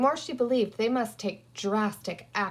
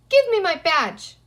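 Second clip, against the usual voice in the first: put-on loud, higher boyish demand; 8 semitones higher, 9 dB louder than usual. A narrator's reading. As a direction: order the sheriff to hand it over. Peter demanding from sheriff.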